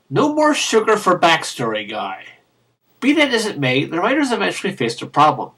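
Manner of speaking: normal talking voice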